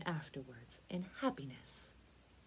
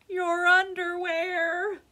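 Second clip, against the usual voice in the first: high, loud, drawn-out call; comic slow shout of a misheard request; 11 semitones higher, 10 dB louder, much slower than usual. A narrator's reading. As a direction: echo the distant holler.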